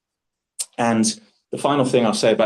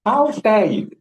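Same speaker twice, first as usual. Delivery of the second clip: mock outrage; 11 semitones higher, slower than usual. Girlfriend scolding him, theatrical mock outrage. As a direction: play the offended diva, overdone. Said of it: "Not like this".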